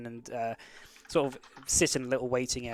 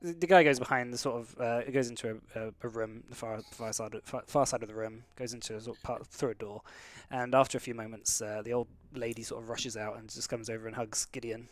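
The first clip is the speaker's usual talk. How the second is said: normal talking voice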